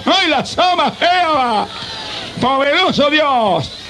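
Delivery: high, drawn-out cry of praise; ecstatic praise. Real, not acted. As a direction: burst upward in rapture. Ecstatic worship outburst. (grito extático de alabanza, agudo y alargado)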